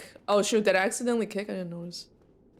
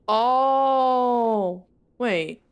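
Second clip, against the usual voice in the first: surprised realisation; 3 semitones higher, 6 dB louder than usual. Real, not acted. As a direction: gasp into a louder, higher oh. Sudden realisation of the route.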